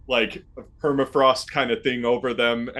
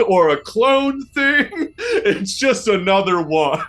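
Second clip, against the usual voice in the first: laughing; 11 semitones higher, slower than usual. Real, not acted. GM laughing at his own clarification.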